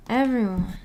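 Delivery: normal talking voice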